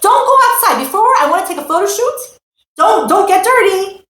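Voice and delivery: high, loud frantic parent warning; anxious mom guarding the new clothes; fussing parent. Retold or imitated. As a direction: shoo the kid away from the dirt. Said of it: "and you say to the kid".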